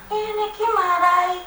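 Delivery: a hint of the nagging husband griping about the motorbike; mock complaining husband. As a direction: whine his complaint back in a mocking way.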